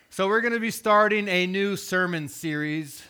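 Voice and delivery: normal talking voice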